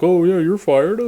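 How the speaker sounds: high, loud mocking quote; loud, high-pitched mock boss; mocking imitation. Mocking retold firing with laugh.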